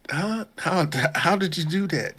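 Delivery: put-on high, astonished girl's question; mock amazement. The girl stunned that he guessed, high mock amazement. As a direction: gasp up high in disbelief.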